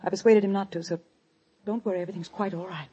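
low, hushed, halting reassurance; trembling reassurance. Acted. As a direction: reassure him while hiding your fear. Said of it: "why was she trembling".

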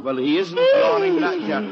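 high, loud exasperated yelling. Acted. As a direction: blow up at the yawning family. Dad exasperated, shouting.

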